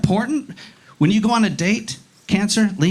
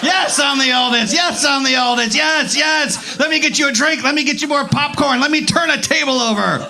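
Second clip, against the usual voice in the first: high, insistent mock-eager boasting; confident oldest child; 5 semitones higher than usual. Oldest in the crowd putting their hand up, overconfident and eager. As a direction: crow it like a cocky firstborn. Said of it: "Look how confident they are".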